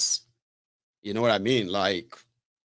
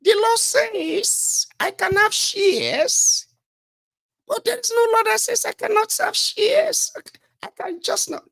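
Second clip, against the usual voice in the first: very high falsetto self-defence; mock lying minister; 4 dB louder, slower than usual. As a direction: imitate a lying minister protesting in a squeal. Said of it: "You don't talk like that".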